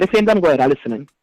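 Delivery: usual speaking voice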